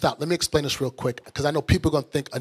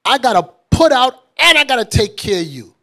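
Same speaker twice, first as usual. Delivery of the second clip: fed-up wife; 4 semitones higher, 7 dB louder than usual. Retold or imitated. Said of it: "she's like".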